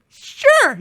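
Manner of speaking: put-on very high, loud toddler voice; squeaky small-child impression; [name]. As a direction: imitate an eager little kid agreeing. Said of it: "[name], can you do that again?".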